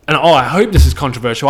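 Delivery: ordinary talk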